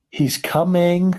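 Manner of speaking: high, drawn-out announcement; excited cry. A narrator's reading. Mister Wonka seeing the boy appear, excited cry. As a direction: cry it out slowly and eagerly. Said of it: "cried Mister Wonka".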